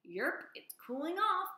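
high, slow croaky frog voice; croaking frog. Acted. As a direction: drag it out like a sleepy frog. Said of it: "croaked one frog to another".

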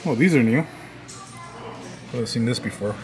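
ordinary talk, nothing marked

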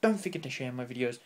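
usual speaking voice

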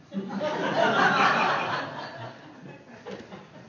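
laugh. Real, not acted.

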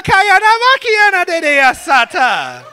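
high, loud laughing babble in tongues; high, loud giggly tongues; mock tongues. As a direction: playfully babble like praying in tongues. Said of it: "praying in some tongues".

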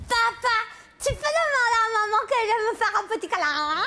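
put-on high whiny little girl; child voice. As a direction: plead sweetly like a small child. Child asks for a cuddle. (voix d'enfant câline)

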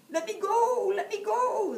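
very high, loud squeaky shout; a tiny creature squealing to be let go; shouting leprechaun. Acted. Caught leprechaun shrieking at Jamie, very high and loud. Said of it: "the leprechaun shouted".

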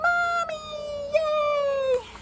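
very high, stretched-out cheer; squealing high childlike joy; overjoyed little bunny. A narrator's reading. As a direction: cheer like a tiny bunny seeing Mommy. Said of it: "And Bunny says".

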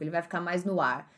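ordinary talk

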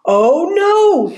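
high, drawn-out oh no; a touch of storybook dismay; dismayed hedgehog. A narrator's reading. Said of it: "Hedgie thought".

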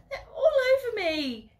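high loud wail with tears; crying yell. Acted. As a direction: yell it in anguish. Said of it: "she yells".

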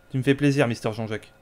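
ordinary talk, nothing marked

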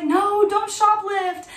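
a fretful pop star pleading; worried plea. Retold or imitated. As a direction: beg her not to, hammed-up. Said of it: "got all worried and she was like".

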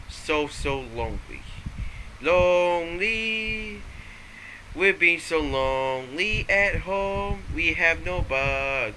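high, slow mock lament; mock lonely wail. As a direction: moan about being lonely, hammed up.

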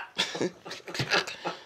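high breathless wheeze of laughter; laughing. Real, not acted. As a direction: wheeze with laughter after the rant. Laughing at acted rant.

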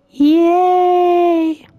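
high, loud mock cheer; sarcastic yay. Real, not acted. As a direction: fake excitement, squealed. Sarcastic cheer about exploring again.

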